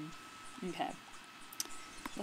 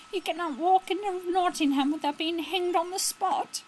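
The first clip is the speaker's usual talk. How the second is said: high-pitched, put-on outlaw voice; hard character voice; 10 semitones higher, 5 dB louder than usual. Outlaw warns against walking into Nottingham, high put-on voice. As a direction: strain up high for a protesting henchman. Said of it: "his voice is so hard".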